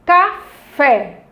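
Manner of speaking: "Café" split, with the final fé punched; ca... FÉ, demonstrated for pupils; stress on FÉ. Shown as a demonstration. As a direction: hit the second syllable of "Café" after a short break. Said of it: "com maior intensidade".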